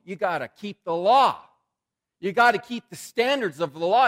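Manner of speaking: loud, insistent mock lecture; mock-stern law-giver; stern priestly demand. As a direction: hammer the rules like a strict priest. Preacher voicing priests' demands.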